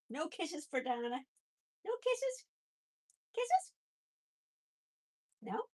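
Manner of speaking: very high coaxing pet voice, rising up. Real, not acted. Begging the pet for kisses, high cooing baby talk. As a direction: squeak it up high to the bunny.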